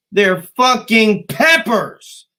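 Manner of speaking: loud, high, drawn-out exasperation; exasperated outburst. Real, not acted. Ranting at growers who overcharge for peppers, loud exasperation. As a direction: hammer each word in indignation. Rant at overpriced pepper sellers.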